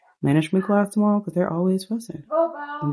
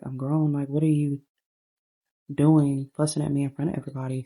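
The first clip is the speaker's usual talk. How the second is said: ordinary talk, nothing marked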